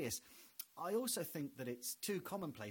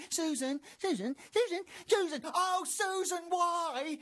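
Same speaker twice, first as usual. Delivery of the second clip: high, loud wailing cries of her name; desperate calling; 14 semitones higher, 9 dB louder than usual. Retold or imitated. Voices in Susan's head wailing her name.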